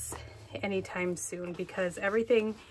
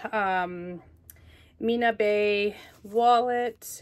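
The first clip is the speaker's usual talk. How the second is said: ordinary talk, nothing marked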